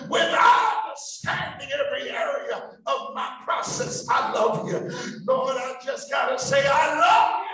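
loud, rising preacher's call; full-force preaching. Acted. Pastor rousing the church to shout praise, full-force preaching. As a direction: whip the congregation up with praise.

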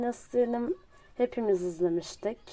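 ordinary talk

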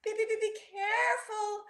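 high, stammering worry; anxious. A narrator's reading. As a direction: stutter a nervous warning. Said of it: "Rocky said anxiously".

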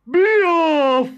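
giant woman shouting. A narrator's reading. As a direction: chase the boy away. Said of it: "said the woman".

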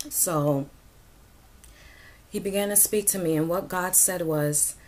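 ordinary delivery

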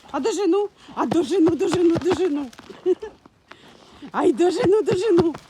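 high, loud teasing chant; gleeful "I'll catch you" taunt; playful chase. Real, not acted. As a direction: chase the dog, laughing at the game.

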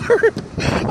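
short high laugh. Real, not acted. Laughing at own freezing state.

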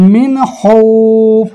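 মিন হউফ said slowly with the vowel held; drawn-out recitation. Shown as a demonstration. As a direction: hold the vowel of হউফ for three alifs. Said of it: "স্পষ্ট করে তাড়াতাড়ি বললে চলে যাবো".

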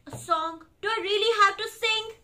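high, loud incredulous question; mock reluctance. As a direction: balk at having to sing. Reader balks at singing.

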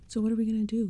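demanding. A narrator's reading. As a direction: demand a plan. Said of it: "Walker demanded".

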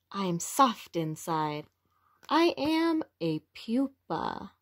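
lively, expressive picture-book read-aloud; reading to children. A narrator's reading. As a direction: read it to little ones with wonder.

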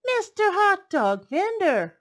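high, loud, slow call; exclaiming. Acted. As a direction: exclaim it gladly. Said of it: "Miss Omu exclaimed".

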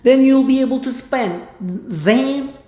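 slow stretched English; slow drill. Shown as a demonstration. Teacher drills the line slowly. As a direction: say each word slowly. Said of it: "slow repetition drill".

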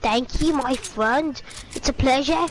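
polite put-on British voice; British accent. Thanking an admiring teammate, British accent. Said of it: "that's amazing voice".

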